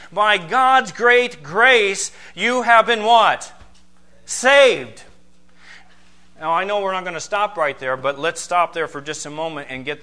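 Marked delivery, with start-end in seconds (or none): 0.1-5.0 building preacher's call. Acted. Preacher builds verse to climax.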